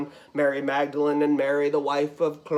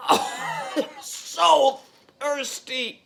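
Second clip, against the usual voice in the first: higher, strained groan fading away; breathless agony; 8 semitones higher, slower than usual. Acted. Dying Jesus asking for drink, pained and breathless. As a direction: gasp it out, fading on the last word. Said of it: "draw in a deep, agonizing breath".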